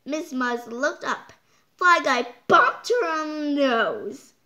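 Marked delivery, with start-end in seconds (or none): none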